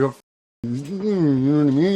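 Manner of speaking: ordinary delivery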